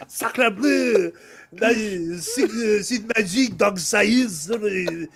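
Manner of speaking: mock French gibberish; hammy stage French; French chef. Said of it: "make what's his face ... French".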